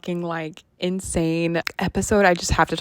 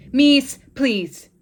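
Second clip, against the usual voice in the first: louder exasperated plea to the dog; pleading call; 5 semitones higher, 6 dB louder than usual. Real, not acted. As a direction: beg the dog to stop, raised.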